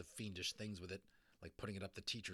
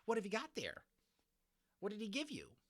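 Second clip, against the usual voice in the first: put-on high, harsh crow-like nosy girl; raucous crow voice; 12 semitones higher than usual. A narrator's reading. Nasty girl April demanding what Patrick got, raucous and high. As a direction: caw it out like a crow. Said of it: "in a raucous voice like a crow's".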